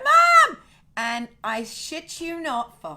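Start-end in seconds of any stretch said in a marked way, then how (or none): 0.0-0.6 high, loud shout of "Mum!". Retold or imitated. Daughter calling to her mother at gunpoint, loud high shout. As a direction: yell out for her mother, much higher and louder. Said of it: "she's like".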